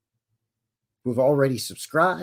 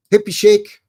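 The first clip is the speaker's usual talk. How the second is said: calling out; 8 semitones higher, 10 dB louder than usual. Real, not acted. Loud shout-out greeting a viewer.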